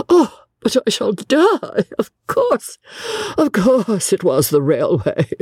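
high, helpless gleeful outburst; high-pitched, overcome with amusement; uncontrollable mirth. A narrator's reading. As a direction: cry it out as if about to collapse with mirth. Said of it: "She could not control her mirth".

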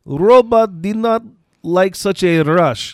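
put-on slow Russian-accented quote. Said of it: "and I quote".

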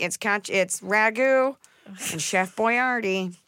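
teasing a co-host's clumsy Italian food names; mocking Emily. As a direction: tease her Italian know-how. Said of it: "Emily's like".

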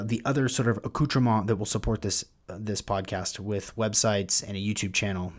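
ordinary delivery

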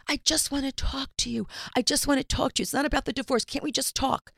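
high, insistent imitation of pleading; whiny-pitched retold plea, held high throughout. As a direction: mimic an ex begging for a conversation.

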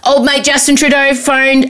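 ordinary talk

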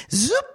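a quick high zoop, like something flying by; cartoon whoosh for a runner blowing past; whoosh sound effect. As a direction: zip the sound out high and fast. Imitates player racing past defender.

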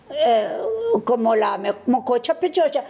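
ordinary talk, nothing marked

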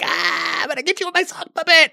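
high, loud campy puppet villain; goofy muffled sock puppet. Pretend. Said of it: "intentionally campy".

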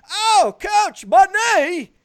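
high, hammed-up British whine about the knee; an injured Brit moaning in a fake British accent. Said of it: "See how it falls apart?".

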